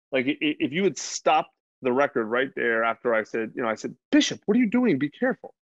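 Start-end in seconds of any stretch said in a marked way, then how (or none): 4.1-5.5 startled, higher-pitched scolding, not yelling; telling a small child off without shouting; parental reprimand. Retold or imitated. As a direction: caution your kid with a quick jump in pitch. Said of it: "I basically said it like that".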